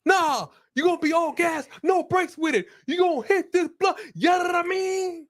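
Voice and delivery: high-pitched, excitable stoner character; high put-on smoker. Urging a friend to be all gas, in a high put-on voice. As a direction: squeal it in a very high comic voice. Riffing as a smoker pushing herb.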